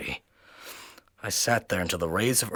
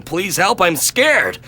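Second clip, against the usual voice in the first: scared plea; 10 semitones higher, 9 dB louder, much slower than usual. Acted. Terrified call to the police.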